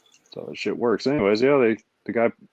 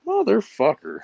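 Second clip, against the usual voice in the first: a sudden high mock outburst, much higher; mock angry curse; 11 semitones higher, slower than usual. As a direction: spit it out like an irritated neighbour. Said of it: "where it's like".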